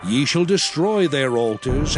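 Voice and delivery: ordinary talk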